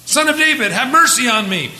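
high, loud desperate plea. Retold or imitated. Blind beggar cries out to Jesus, loud and high. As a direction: beg at the top of your voice. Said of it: "he cried out all the more".

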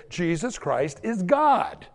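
ordinary talk, nothing marked

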